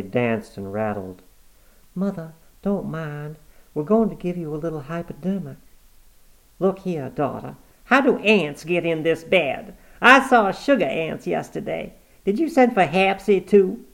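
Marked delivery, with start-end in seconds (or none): 2.0-5.6 soothing daughter. Acted. Cornelia soothing her mother at the bedside, tender. 6.6-13.8 put-on higher, louder peevish old mother; cranky old woman. Granny complaining about her face being washed, peevish.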